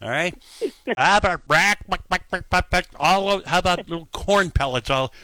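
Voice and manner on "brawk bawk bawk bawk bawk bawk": mock chicken squawk then clucks; quick, staccato play-clucking. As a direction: cluck playfully like a hen. Imitates chickens on the air.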